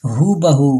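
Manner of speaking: ordinary talk, nothing marked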